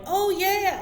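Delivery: high, loud drawn-out agreement; sing-song amen-corner cheer; mock eager agreement. Acted agreeing reply in talk.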